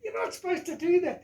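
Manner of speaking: high, loud shocked outcry; high-pitched yelp of outrage; shocked ranger. Retold or imitated. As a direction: blurt it out, scandalised. Ranger shocked at dynamite fishing.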